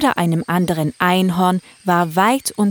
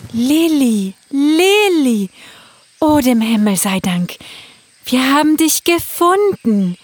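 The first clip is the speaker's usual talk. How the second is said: put-on a mother finding her child; 8 semitones higher, 6 dB louder than usual. Mother unicorn voice.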